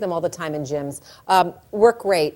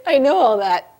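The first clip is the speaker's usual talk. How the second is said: louder, higher comeback; impatient retort; 5 semitones higher, 7 dB louder than usual. Acted. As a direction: cut him off impatiently. Woman snaps back at husband.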